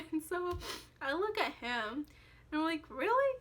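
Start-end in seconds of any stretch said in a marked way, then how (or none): 2.9-3.4 much higher, louder disbelief; shocked at the fiance's choice; incredulous. Retold or imitated. Disbelief at another round.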